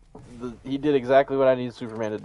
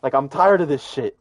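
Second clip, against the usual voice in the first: fed-up fan rant; 3 semitones higher, 5 dB louder than usual. Retold or imitated. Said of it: "he was screaming".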